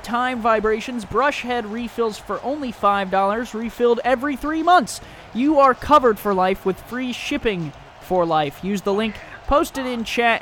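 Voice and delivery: loud upbeat sponsor read. Acted. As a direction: sell it like a radio spot. Reads a sponsor ad live.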